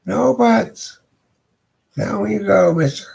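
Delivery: woman's voice. Acted. The lady at the door teases Fabian in a high voice.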